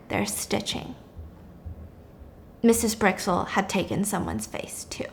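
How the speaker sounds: hushed unvoiced reveal; whispering. A narrator's reading. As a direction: whisper the twist.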